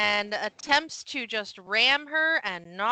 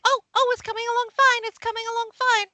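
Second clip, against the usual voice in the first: high, fast, eager chatter; flustered excitable pup; 12 semitones higher, 5 dB louder, much faster than usual. Acted. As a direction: chirp back hurriedly as the excitable little helper. Voices flustered Mudbone's reply.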